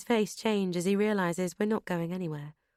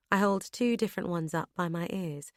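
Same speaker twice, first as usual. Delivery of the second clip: ordinary delivery, nothing marked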